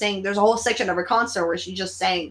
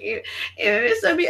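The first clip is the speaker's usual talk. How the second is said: tearful; 12 semitones higher, faster than usual. Retold or imitated. Overwhelmed fan quote.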